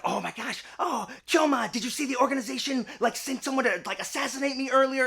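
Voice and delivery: put-on high voice, playing along eagerly; cat-eared cafe girl. Cat girl plays along with Kyoma's conspiracy, high mock alarm. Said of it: "who engages in the".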